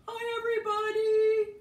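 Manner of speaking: high, bright puppet hello. Acted. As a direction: greet like a cheerful puppet. Bob puppet greeting.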